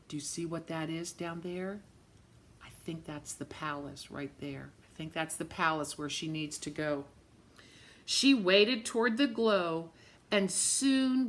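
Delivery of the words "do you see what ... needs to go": soft, low, secretive aside; hushed, excited storyteller aside; hushed wonder. Real, not acted. Reader hushes for palace reveal.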